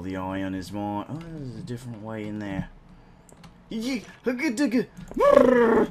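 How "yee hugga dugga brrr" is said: high loud cartoonish gibberish; silly goofy voice. Gamer goofing off, high silly cartoon voice. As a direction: ham it up like a cartoon caveman.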